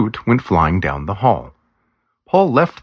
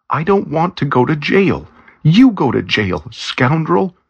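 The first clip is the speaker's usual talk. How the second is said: high-pitched angry cry at him; angry scream; 10 semitones higher than usual. A narrator's reading. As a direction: scream it at him, refusing jail. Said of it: "Lisa screamed angrily".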